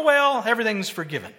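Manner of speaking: normal talking voice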